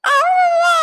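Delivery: hammed-up wail of a boy begging his grandmother; wailing call. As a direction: howl for granny to be spared school.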